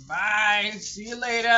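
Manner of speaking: mock send-off shout. Joking dismissal of a crying child's visitor, loud playful holler. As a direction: playfully holler them out the door.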